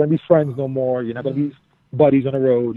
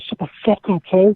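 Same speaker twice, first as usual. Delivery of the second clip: higher-pitched, mock furious shout; retold angry yell; 5 semitones higher than usual. Drunk wrestler answers a woman below, mock angry yell. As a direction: bark it like a drunk friend's comeback.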